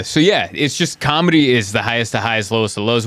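ordinary talk, nothing marked

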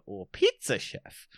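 high, drawn-out mock Italian; Italian accent. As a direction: ham up an Italian accent on the option. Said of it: "can do an Italian accent".

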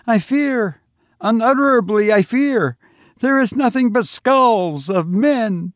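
high, loud, terrified repetition; pilgrim repeating his fear, pitched high and trembling; shuddering fear. A narrator's reading. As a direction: shudder through it in dread. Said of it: "The other, shuddering, repeated".

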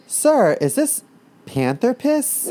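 put-on high, slow, timid kid voice. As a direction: ask the dentist like a small kid. Kid asking dentist.

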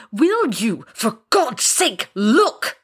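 slow, high exasperated demand; barely repressed rage. A narrator's reading. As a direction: plead angrily, stretching each word. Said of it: "trying to repress the blinding rage".

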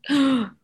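sharp mocking gasp of fake surprise. Mocking clueless friends, a fake shocked gasp. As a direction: gasp like you just heard the news. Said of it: "not an audible gasp, girl".

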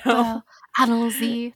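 imitated French accent. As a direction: playfully mimic a French accent. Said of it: "say chopper in a French accent".

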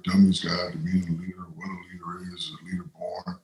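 whispered list of book titles; a near-silent murmured list. Real, not acted. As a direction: rattle off the titles in a whisper, trailing away. Listing leadership book titles under breath.

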